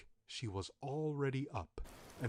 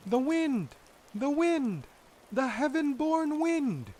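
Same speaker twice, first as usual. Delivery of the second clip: put-on high, loud singsong child voices; children's voices; 9 dB louder, slower than usual. Children answering the witch, high loud child voices. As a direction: answer sweetly like innocent children.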